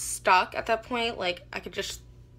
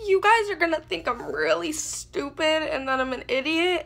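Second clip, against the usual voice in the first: higher, half-crying plea to her parents; retold tearful confession; 6 semitones higher than usual. Confessing to her parents at night, tearful and high. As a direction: tearfully admit the mistake, voice rising. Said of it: "started crying".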